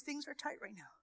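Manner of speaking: soft, near-whispered trust; soft hushed reassurance; hushed calm confiding. Shown as a demonstration. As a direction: tell the kids quietly, steadily. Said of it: "It's okay to tell your kids".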